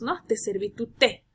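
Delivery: normal talking voice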